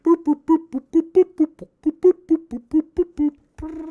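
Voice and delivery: high squeaky mock dial-tone boops; cartoon phone beeping; phone dial beeps. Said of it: "just been like".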